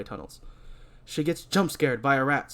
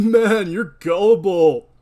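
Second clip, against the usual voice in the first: mocking scoff; 6 semitones higher, 10 dB louder, slower than usual. Mocking the gullible guy.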